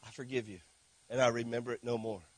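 gentle Jesus voice. Acted. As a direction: forgive quietly, like a hand on the shoulder. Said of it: "you say, ... forgive me".

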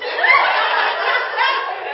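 high, loud laugh; real burst of laughter. Real, not acted. Laughs at friend's habits.

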